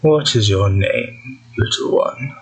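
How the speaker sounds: slow, measured eerie voice; cold, precise, stern. Acted. Mysterious voice questioning Gira in a dream, cold and stern. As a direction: draw each word out with cool precision. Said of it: "Very cold, precise, almost stern".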